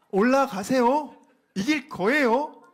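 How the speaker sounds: mock him with exaggerated cheer; loud sarcasm. Shown as a demonstration. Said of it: "요렇게 말을 하는 거예요". (크고 높게 비아냥거리며)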